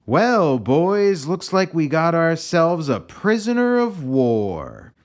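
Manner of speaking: high, loud, slow drawling cowboy; cowboy commander. Acted. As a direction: lord it over the boys with a slow drawl. Wild Bill addresses his men.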